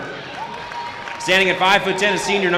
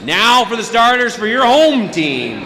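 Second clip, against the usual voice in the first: big booming home team introductions; hyped arena announcer; 7 semitones higher, 5 dB louder than usual. Acted. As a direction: sell the home starters to the crowd.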